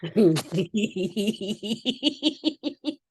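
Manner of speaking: long helpless burst of laughter; real belly laugh, can't stop; hearty laugh. Real, not acted. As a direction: crack up for real. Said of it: "I wasn't supposed to laugh that hard".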